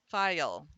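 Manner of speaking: "File" said loudly as fi-yul; two syllables. Shown as a demonstration. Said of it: "they really do say it as two syllables".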